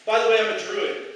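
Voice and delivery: loud, quick mock announcement; mock matter-of-fact. Comic pretending to tell a date he's a druid, mock casual. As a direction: say it like it's normal. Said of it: "if I was just like".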